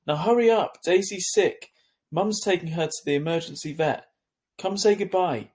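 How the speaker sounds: high, loud and urgent; urgent yell. A narrator's reading. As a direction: push him to hurry, loud. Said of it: "she yelled".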